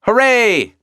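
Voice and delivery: high triumphant cheer; victorious dog. Acted. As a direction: celebrate the win. Dog wins tug.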